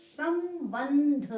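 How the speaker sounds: संबंध very slowly, both halves drawn out; nasal syllables. Shown as a demonstration. Teacher sounding out spelling for students, संबंध slow with humming nasals. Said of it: "दोनों हमारे नासिका से बोले जा रहे हैं".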